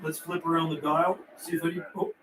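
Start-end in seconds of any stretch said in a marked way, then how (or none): none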